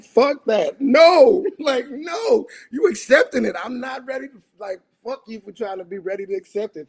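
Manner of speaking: high-pitched, incredulous outburst; retold grieving indignation; angry refusal. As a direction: burst out on the no, then keep protesting high. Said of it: "I'm angry 'cause I'm like".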